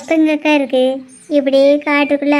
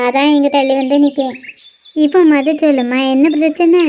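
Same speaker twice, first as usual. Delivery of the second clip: usual speaking voice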